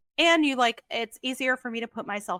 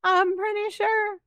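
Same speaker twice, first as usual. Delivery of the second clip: mock protest; 10 semitones higher, 6 dB louder than usual. Listener doubting an audiobook narrator's accent, high mock protest. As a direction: poke fun in an exaggerated high voice.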